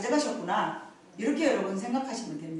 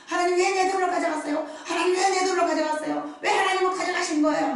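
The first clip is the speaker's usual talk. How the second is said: high, louder complaining cry; complaining to God; 8 semitones higher, 4 dB louder than usual. Retold or imitated. Preacher retelling her losses, complaining to God. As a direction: whine at God, grievance rising. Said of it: "막 이렇게 불평을 했더니".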